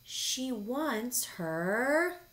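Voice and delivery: very slow build to a high "quilt"; storybook servants announcing together; triumphant chorus. A narrator's reading. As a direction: stretch it out, then reveal "quilt". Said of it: "they all said together".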